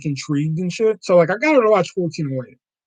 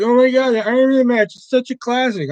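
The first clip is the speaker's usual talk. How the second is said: mock fan gushing, pitched up a little louder; 8 semitones higher, 3 dB louder than usual. Mocking fans who call the match a classic, mock gushing. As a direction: sneer as the fans rave. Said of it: "People were like".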